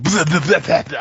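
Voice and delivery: loud mock cartoon-devil gibberish; Tasmanian devil babble. Troll playing the actual Tasmanian devil character, loud mock babble. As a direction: spew out a loud, silly creature babble. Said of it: "I was a Tasmanian devil".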